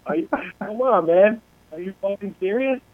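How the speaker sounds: retold "oh come on" incredulity; incredulous. Speaker recalls his reaction to panicked people, incredulous.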